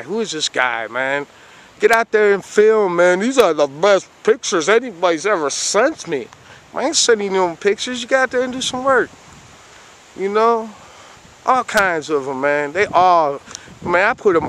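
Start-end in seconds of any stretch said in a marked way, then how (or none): 1.8-10.7 mocking other guys. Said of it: "And everybody's like".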